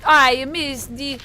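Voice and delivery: loud, drawn-out mock Italian outcry; exasperated gamer in a put-on Italian accent; exclaiming Italian accent. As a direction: wail it slowly in a hammed-up Italian accent. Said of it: "I think we're done with Italian".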